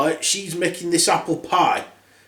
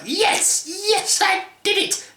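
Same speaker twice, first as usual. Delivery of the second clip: high cackling triumph; hammed-up high witch cackle; victorious witch; slower than usual. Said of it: "laughing in victory".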